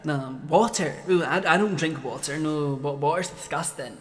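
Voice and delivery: a hint of a fussy schoolmate, kept low; random snob. Random person at school turning down water, mildly disgusted. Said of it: "some random person would be like".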